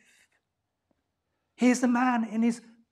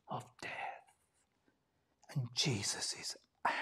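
breathy whisper, much softer and lower; dramatic whisper; 8 semitones lower, 14 dB quieter, faster than usual. Acted. Preacher to congregation, dramatic whispered reveal. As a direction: let it land as a stunned whisper.

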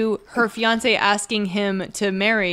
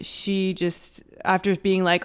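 ordinary delivery

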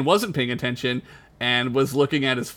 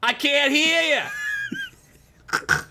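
much higher mock shout; mock yell; 12 semitones higher than usual. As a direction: bellow it back across the dock. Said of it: "looks up from his phone and says".

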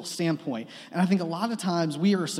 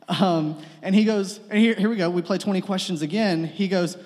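normal talking voice

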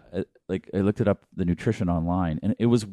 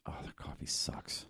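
whisper; 20 dB quieter than usual. Real, not acted. Aside about coffee.

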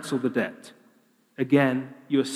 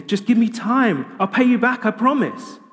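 high, louder desperate begging; pleading servant; 8 semitones higher, 3 dB louder than usual. Acted. Servant begging his master for time, high pleading. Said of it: "all he's saying is".

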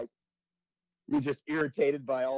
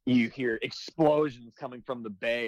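usual speaking voice

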